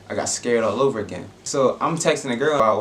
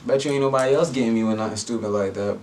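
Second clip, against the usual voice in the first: ordinary delivery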